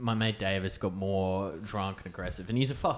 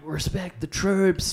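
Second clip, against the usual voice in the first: mock patriotic; 9 semitones higher, slower than usual. Mocking drunk mates.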